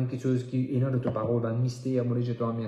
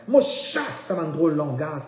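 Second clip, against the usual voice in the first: casting-out shout; 3 semitones higher, 3 dB louder, slower than usual. Real, not acted. Preacher chasing away ungodly language, shouting. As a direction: drive it out with your voice.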